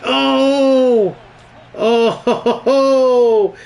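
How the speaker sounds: high, loud shocked cry; real shock at the play; surprised shout. Real, not acted. Big moment in the match.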